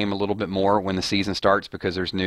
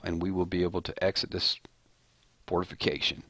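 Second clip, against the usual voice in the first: softer, measured character voice; Eugene; 2 semitones lower, 7 dB quieter than usual. Acted. Host mimics Eugene proposing the walker wall plan, soft deadpan impersonation. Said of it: "it is Eugene that says".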